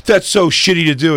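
high-pitched mock outrage; mock offended. Uninvolved people scold the comic, mock offended.

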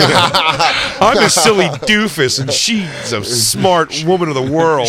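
slow, high mock-presenter delivery; corny pitch intro. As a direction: stretch it like a rehearsed cheesy couple joke. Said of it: "they still have the corny thing though".

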